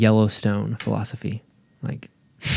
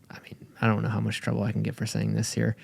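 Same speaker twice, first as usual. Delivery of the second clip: quick secretive whisper; conspiratorial whisper; 7 dB quieter, faster than usual. Real, not acted. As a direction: rush it out in a whisper. Worried about saying too much.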